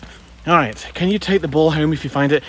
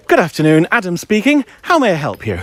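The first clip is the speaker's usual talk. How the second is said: higher, upbeat phone greeting; polite and friendly; 6 semitones higher than usual. Shown as a demonstration. Worker answers phone at work, polite friendly greeting. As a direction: answer the work phone nicely, pitch lifted. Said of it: "just be polite, nice and friendly".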